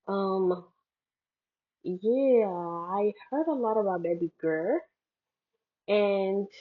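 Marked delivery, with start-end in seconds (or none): none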